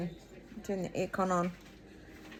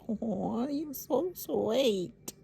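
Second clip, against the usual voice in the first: ordinary delivery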